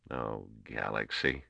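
soft and slow, under the breath; quiet. A narrator's reading. A young trader hearing bad news, quiet and slow. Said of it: "Les Gorm said quietly".

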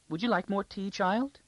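high, sweet voice of a plump court lady; high-voiced lady. A narrator's reading. Court lady offering Arcadia tea, high-voiced. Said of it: "Her voice was high".

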